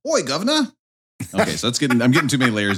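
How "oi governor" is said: high mock London copper call; London policeman accent. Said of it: "London policeman".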